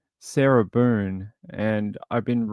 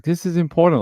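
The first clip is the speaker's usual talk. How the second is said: higher, louder insistent imitation; mock urgent plea; 6 semitones higher, 4 dB louder, faster than usual. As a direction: parody her pushy typed demand. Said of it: "Like, this is how she types".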